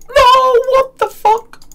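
very high, loud shriek of disbelief; shocked screaming. Real, not acted. Gamer hit by the forty-two Gmaul, shocked screaming.

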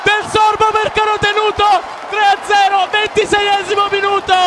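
high, disbelieving goal celebration; can't believe it, shout with joy; ecstatic goal call. Real, not acted. (gol gridato incredulo ed esultante)

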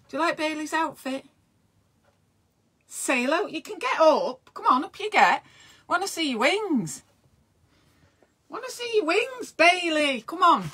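high coaxing voice to the dog; pet talk. Real, not acted. Talking to Bailey the dog, high coaxing pet voice. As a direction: coo at the dog to get up.

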